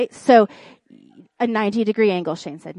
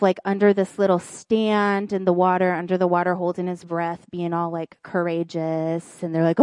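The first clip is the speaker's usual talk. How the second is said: normal talking voice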